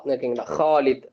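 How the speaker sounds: ordinary talk, nothing marked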